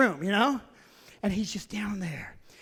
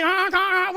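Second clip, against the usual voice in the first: honking horn noises; imitated honking; 7 semitones higher, 6 dB louder than usual. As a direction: honk like a car horn. Imitating car horns.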